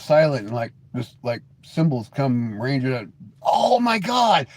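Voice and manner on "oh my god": high, drawn-out shout; excited outburst. Real, not acted. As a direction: shout it slowly with delight. Delighted by own idea.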